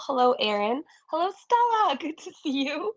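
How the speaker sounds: usual speaking voice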